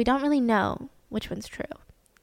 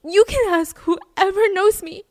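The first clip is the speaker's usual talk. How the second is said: a distraught suspect, louder and higher, protesting his innocence; pleading Jeremy; 7 semitones higher, 5 dB louder than usual. Retold or imitated. Suspect begging police to believe him, in a loud, high, desperate voice. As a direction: insist they tried to stop her, voice straining upward. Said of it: "And he's like".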